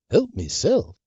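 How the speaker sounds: usual speaking voice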